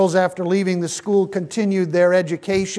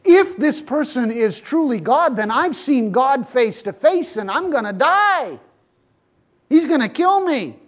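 higher, louder, rising mock panic; panicked Gideon; 7 semitones higher, 6 dB louder than usual. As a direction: play a terrified man sure he is about to die. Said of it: "because he says".

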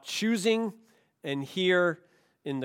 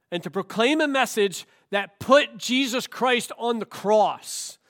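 rising, louder preacher's call; preaching at full force; 6 semitones higher, 5 dB louder than usual. Acted. Preacher urging the congregation to proclaim, rising to a fervent peak. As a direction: build the sermon to a climax.

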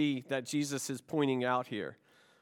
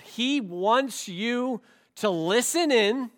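raised, measured pulpit delivery with punched key words; emphatic preaching; 5 semitones higher, 5 dB louder than usual. Real, not acted. Preacher urging the congregation to have joy, emphatic and forceful.

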